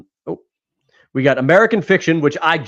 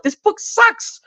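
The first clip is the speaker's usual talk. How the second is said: much higher, strained voice of disgust; mock disgust; much slower than usual. Writer mocking the bestseller he hates, high squeal of disgust. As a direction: squeal it like you can't believe how bad it is. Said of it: "and he's like".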